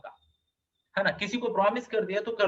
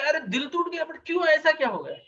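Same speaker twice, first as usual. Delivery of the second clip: higher, faster, surprised question; startled higher voice asking why; surprised concern; 7 semitones higher than usual. Retold or imitated. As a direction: ask in startled disbelief. God asks weeping Shesha.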